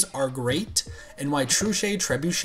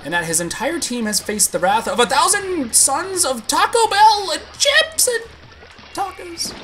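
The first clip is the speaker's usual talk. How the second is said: building excitement; 12 semitones higher, 4 dB louder than usual. Real, not acted. Streamer getting carried away, climbing to a squeal. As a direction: voice climbing higher and louder into a squeal. Sleepy rambling, voice climbs wildly.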